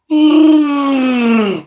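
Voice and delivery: high, loud yawning roar like Chewbacca; loud Chewbacca yawn cry. Pretend. Imitating Chewbacca's roar as a yawn. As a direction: let out a big wookiee yawn-howl.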